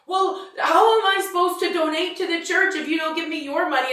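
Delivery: guilt-trip her son; whining mother. Retold or imitated. Mother whining over money.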